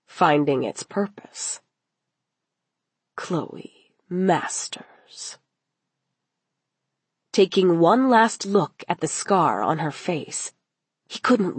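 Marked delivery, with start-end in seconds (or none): 3.1-5.4 slow, whispered name; menacing whisper. A narrator's reading. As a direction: whisper the name slowly, savouring it. Villain savours victim's name in narration.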